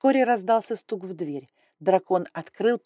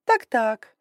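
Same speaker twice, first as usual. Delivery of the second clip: louder musing so-so; haughty dragon lady; 4 semitones higher, 6 dB louder than usual. Acted. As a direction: hum it while inspecting. Grai looking around.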